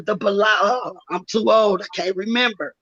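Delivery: usual speaking voice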